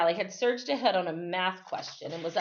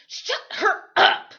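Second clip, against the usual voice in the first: higher, louder hissed snap; 14 semitones higher, 9 dB louder, slower than usual. Acted. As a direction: hiss it at a teammate, urgent. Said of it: "I heard Claire hiss".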